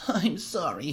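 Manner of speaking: high, mild sobbing apology; a touch of sobbing on sorry. Acted. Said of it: "erupted into huge chest sobs".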